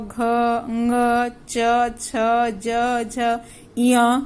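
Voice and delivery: ordinary talk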